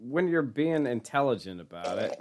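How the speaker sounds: ordinary talk, nothing marked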